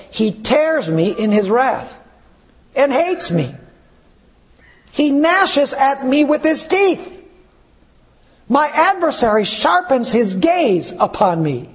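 slow, solemn reading of a lament; scripture reading. Acted. Preacher reading Job's cry to the church, solemn. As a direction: read it like holy grief.

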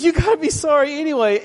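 sing-song mockery of himself; mock self-quote. Pastor mocking his own advice to the girls.

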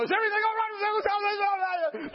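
high frantic repeated holler; alarmed shouting. Retold or imitated. Guards bounding in to rescue preacher, high frantic yell. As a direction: burst in yelling on one high note.

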